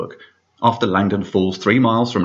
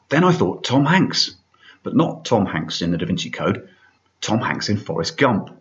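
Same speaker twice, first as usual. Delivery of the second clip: usual speaking voice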